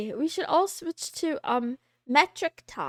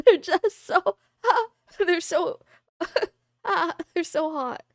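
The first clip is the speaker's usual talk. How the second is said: higher-pitched, laughing between broken-off phrases; too tickled to finish the sentence; giggly gushing; 3 semitones higher than usual. Real, not acted. Gushing to viewers over her Bun Boys, giggly and smitten.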